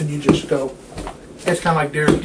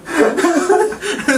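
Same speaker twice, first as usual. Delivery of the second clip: high cackle; 11 semitones higher than usual. Real, not acted. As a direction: crack up at the story. Laughing at own bit.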